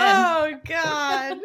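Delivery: high, loud "oh God"; loud exclamation. Real, not acted. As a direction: cry out in amusement. Reacting to the laughing.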